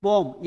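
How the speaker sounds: पोम as one syllable, "pome"; पोम with no y glide; taught reading of poem. Shown as a demonstration. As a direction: say पोम rhyming with "home". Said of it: "क्या पढ़ते हैं".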